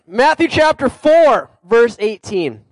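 a touch of announcer reading the passage; announcing the verse. Acted. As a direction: call out the reference to the room. Announcing scripture reference.

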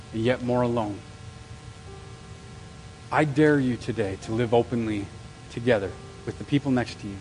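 quiet heartfelt sermon close; intimate appeal. Acted. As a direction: draw the room in close and plead. Sermon closing.

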